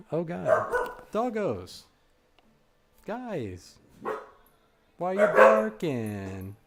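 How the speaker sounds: high, alarmed call to the dogs; startled, calling out to the dogs. Real, not acted. Dogs barking at door.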